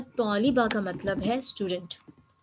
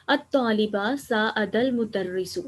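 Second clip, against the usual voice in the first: slow corrected Arabic reading; 3 dB louder, much slower than usual. Shown as a demonstration. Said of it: "sorry".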